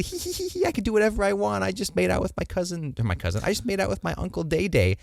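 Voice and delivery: high, giggly mock girl; giggly Rhaenyra. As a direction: giggle it like a smug teenager. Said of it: "Rhaenyra is just giggly".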